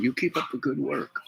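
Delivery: high-pitched imitation of an encouraging agent; agent's encouragement. Departing agent praises the young inventor, high-pitched imitated encouragement. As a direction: lift the voice well up for quoted praise.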